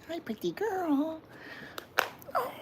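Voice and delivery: slow, very high soft coo. Real, not acted. Doting on the cat in a slow, very high coo. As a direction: dote on her slowly.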